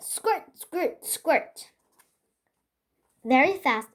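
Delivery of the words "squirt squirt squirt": high playful "squirt, squirt, squirt"; squirting noise. Acted. Child pretending to squirt herself, high voice. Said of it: "I said".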